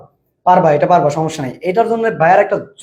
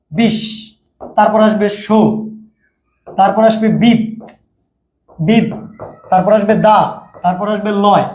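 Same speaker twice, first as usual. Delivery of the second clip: বিশ, সো, বিদ, দা, লয় each set apart by pauses; split syllable by syllable. Shown as a demonstration. Teacher showing how many students would split it, isolated syllables. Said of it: "অনেকের বের হবে এভাবে".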